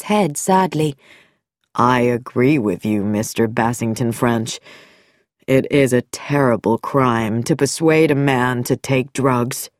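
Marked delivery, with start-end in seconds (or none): 1.7-9.7 lower, smooth-spoken doctor voice; a calm doctor hiding something; sinister doctor. Acted. Dr Nicholson voiced.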